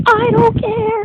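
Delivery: high, far voice, tossing it off; sing-song high dismissal; squeaky high I don't care. Real, not acted. To the viewer, a high mock-carefree voice. Repeating she doesn't care.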